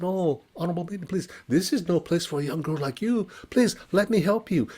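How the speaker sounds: high, gentle pleading merchant; kind merchant. Acted. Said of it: "He said".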